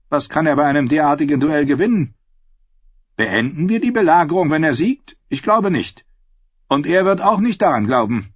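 high, loud, quick doubting voice; sceptical knight. Acted. Sir Forley questioning the duel idea, sceptical and quick. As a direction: pick the duel apart with scorn.

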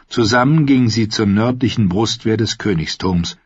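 plain reading voice

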